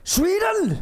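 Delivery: very high disbelieving squeak; incredulous. Retold or imitated. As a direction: sound appalled at the idea. Dane reacts with disbelief.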